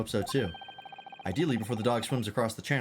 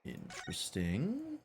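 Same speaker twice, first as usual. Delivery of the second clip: slow, much softer "Interesting" with a rising end; hushed intrigue; 3 semitones higher, 8 dB quieter, much slower than usual. Acted. Detective eyeing the box, slow and hushed. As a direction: draw out "Interesting" under the breath. Same word said twice.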